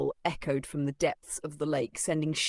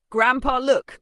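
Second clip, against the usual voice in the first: high, loud excited shout; excited call; 9 semitones higher, 11 dB louder, slower than usual. Acted. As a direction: call grandpa over, thrilled. Boy spots box, calls out.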